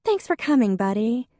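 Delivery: high, bright call of his name; Faith greeting. A narrator's reading. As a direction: sister greeting her brother. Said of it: "Faith said".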